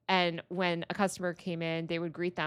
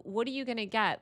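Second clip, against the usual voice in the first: normal talking voice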